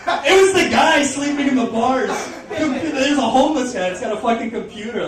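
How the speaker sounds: loud, high-pitched disbelief; incredulous outburst. Real, not acted. Comic telling the crowd who owns the computer, incredulous. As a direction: burst out with the astonishing reveal. Astonished punchline about the homeless guy.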